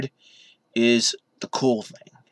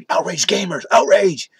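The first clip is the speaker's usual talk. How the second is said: mock outrage; 7 semitones higher, 4 dB louder than usual. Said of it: "and all that good stuff".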